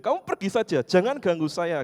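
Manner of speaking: put-on high, loud old lady shooing him off; imitating a furious grandma; angry old woman. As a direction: chase him away in a fierce grandma voice. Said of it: "semakin keras nadanya".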